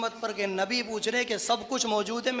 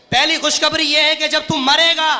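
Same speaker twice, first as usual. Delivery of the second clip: loud, high, driving pulpit oratory; full-force preaching; 7 semitones higher, 11 dB louder than usual. Retold or imitated. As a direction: hammer each phrase, building to a shouted climax.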